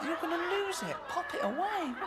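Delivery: very high, giggling voice of a panicking soldier; squeaky mocking commando. Comic mocks commandos hiding in barbed wire, squealing high. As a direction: squeal it out, half laughing, as a flustered commando.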